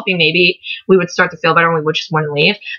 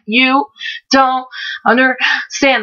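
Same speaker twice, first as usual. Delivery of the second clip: halting, word-by-word imitation of breathlessness; struggling to talk; 5 semitones higher, much slower than usual. Shown as a demonstration. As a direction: force out each word separately, higher, with gaps between. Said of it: "how I was talking 'cause I couldn't ... get anything out".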